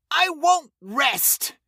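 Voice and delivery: panicked refusal shouted into the dark; terrified scream. A narrator's reading. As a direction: scream it in defiance and terror. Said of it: "Lenny screamed".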